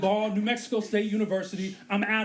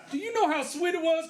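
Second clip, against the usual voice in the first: ordinary talk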